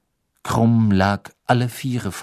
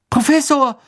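high, loud exclamation; urgent call; 7 dB louder than usual. Acted. Harry ducking past Snape to Dumbledore, calling urgently. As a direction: call out to get his attention.

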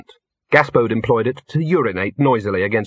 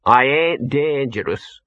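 slow, higher-pitched dog's wail; drawn-out, mildly wailed complaint; 4 semitones higher, slower than usual. A narrator's reading. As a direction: protest plaintively as a put-upon talking dog. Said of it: "wailed Gaspode".

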